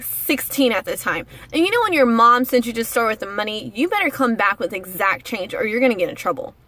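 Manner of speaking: ordinary talk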